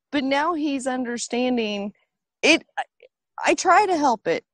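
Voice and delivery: usual speaking voice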